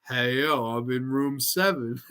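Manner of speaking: low, slow movie tough-guy impression; Stallone. Imagining the actor's cut of the film, low slow impression. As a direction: drop it low and drag the words. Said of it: "where you could hear Stallone".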